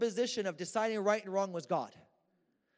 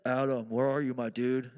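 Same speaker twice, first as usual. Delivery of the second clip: low, loud God calling out casually; playful low-pitched voice of God; God's voice; 6 semitones lower, 4 dB louder than usual. Acted. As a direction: drop deep and louder, calling for Adam. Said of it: "God shows up and he says".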